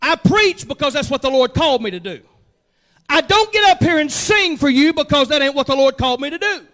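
loud, driving sermon; preaching. Acted. Pastor telling the church his calling, preaching loud. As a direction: preach it out to the room.

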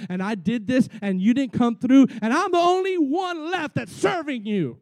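loud, whiny complaint to the Lord; a hammed-up prophet's sulky rant; tantrum. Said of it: "he threw some pretty good tantrums".

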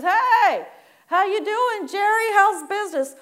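high, loud hearty greeting; cheery friend. Acted. Friend hailing Jerry with a loud cheery hello. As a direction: greet him like a chipper buddy.

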